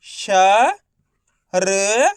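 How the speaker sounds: श and र each held alone; letter-by-letter sounding. Shown as a demonstration. Teacher repeating the method for learners, श and र stretched apart. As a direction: sound out श, pause, then र. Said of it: "एक अक्षर का उच्चारण करके इनको पढ़ रहा हूँ".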